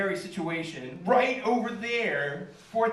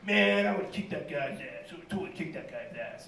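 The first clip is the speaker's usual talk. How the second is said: muttered grumbling; 2 semitones lower than usual. Retold or imitated. Comic imitating the man walking off, muttered mock bravado. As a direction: imitate a loser grumbling as he leaves. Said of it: "they hear him muttering".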